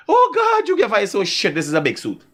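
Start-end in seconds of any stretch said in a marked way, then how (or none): 0.1-1.1 squealed high "Oh God"; mock amazement. Retelling what the girl said, squealed high and amazed. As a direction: echo her gush in a high squeal. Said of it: "I say".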